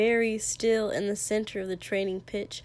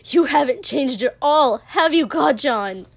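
loud, raised-pitch accusation; vicious; 4 semitones higher, 8 dB louder than usual. A narrator's reading. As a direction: throw it viciously at your rival. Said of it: "Izuku added viciously".

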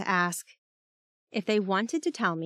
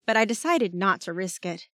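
ordinary delivery

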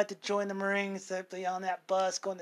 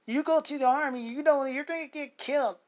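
higher, pleading warning; worried warning; 6 semitones higher, 3 dB louder than usual. Retold or imitated. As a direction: voice the worried friend. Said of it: "And, like".

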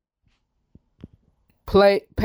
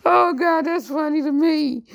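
laughing; 11 semitones higher than usual. Real, not acted. Laughing at kidnapping hoax sentence.

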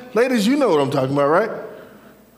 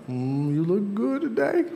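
playful admiration; 5 semitones higher, 3 dB quieter than usual. Acted. God admiring someone, voiced playfully.

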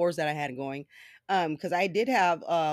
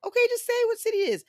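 fast, much higher, louder taunting; mocking taunt; 5 dB louder, faster than usual. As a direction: needle him, quick and high. Taunting the scammer on the phone.

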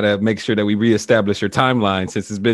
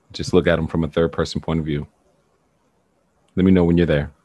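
guided meditation; 2 semitones lower, 3 dB louder than usual. Acted. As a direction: guide them gently through the past. Leads a guided regression meditation.

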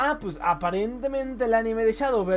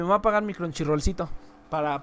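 ordinary delivery

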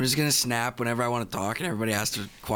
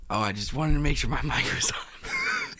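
hoarse whisper with a laugh; 5 semitones higher than usual. Real, not acted. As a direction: whisper it with no voice left. Voiceless guy whispering in.

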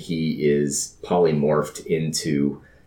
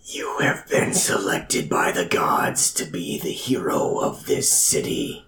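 breathy divine whisper; dramatic whisper; 3 semitones lower than usual. Acted. God speaks into a man's mind, dramatic whisper. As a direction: whisper it like a voice from the gods. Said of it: "a dramatic voice".

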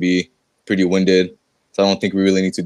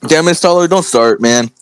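scolding shout; 6 semitones higher, 5 dB louder than usual. Real, not acted. Scolding the dog.

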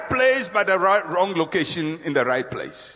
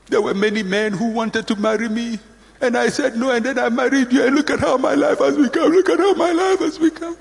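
wife's lament climbing steadily higher; bitter complaining; 4 semitones higher than usual. Acted. Wife blaming the husband she married, bitterly complaining. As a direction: build from plain grievance to high-pitched wailing complaint. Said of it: "Cave people talk like that".

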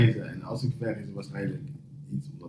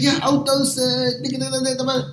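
high, fumbling broken Dutch; 11 semitones higher, 5 dB quieter than usual. Retold or imitated. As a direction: grope for words like the delivery guy in broken Dutch. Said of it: "de meest gebroken taal". (hoog, zoekend gebroken Nederlands)

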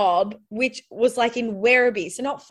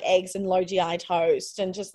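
normal talking voice

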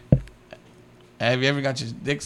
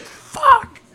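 much higher and louder, a shrill yelp; squealed curse; 9 dB louder than usual. Retold or imitated. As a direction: let it out as a high squeak. Retelling licking moment, squealing.

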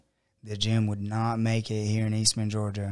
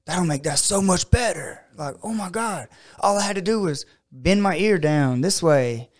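higher-pitched excited self-quote; lively mock amazement, pitched up; excited realisation; 6 semitones higher, 3 dB louder than usual. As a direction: act out a sudden breakthrough. Acts out own sudden realisation.

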